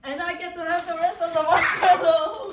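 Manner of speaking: loud, high, laughing cheer; excited laughing shout. Real, not acted. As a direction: crow over winning, laughing. Winner gloating over the prize.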